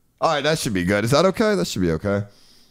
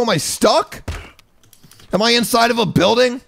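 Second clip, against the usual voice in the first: confused shouting; 6 semitones higher, 6 dB louder than usual. Real, not acted. Lost in game, shouting.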